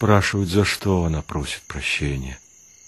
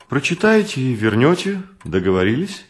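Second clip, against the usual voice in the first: higher-pitched pointed question; meaningful; 6 semitones higher than usual. A narrator's reading. Woman lending a book to a guest, meaningfully. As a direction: ask it with a significant, knowing weight. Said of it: "многозначительно произнесла она".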